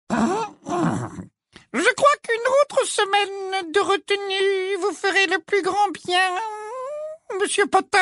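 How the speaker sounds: very high, very slow, oily; honeyed menace; unctuous voice. A narrator's reading. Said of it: "dit Ombrage d'une voix onctueuse". (voix onctueuse)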